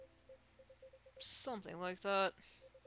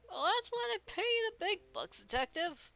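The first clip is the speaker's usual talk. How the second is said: very high made-up officer voice; Dooley; 12 semitones higher than usual. Acted. Officer caught out by the detective, high-pitched made-up voice. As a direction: pitch the character's retort way up. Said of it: "another guy make up another voice".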